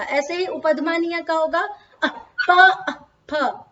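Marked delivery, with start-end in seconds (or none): 2.0-3.6 slow अह प, अह फ with a cut-off breath before प and फ; upadhmaniya demo. Shown as a demonstration. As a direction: clip the अह short before प and फ, slowly. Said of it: "अह पूरा नहीं निकलता".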